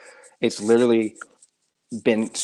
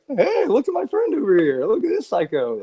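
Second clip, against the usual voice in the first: mocking voice; 12 semitones higher than usual. Mocking a party photo caption.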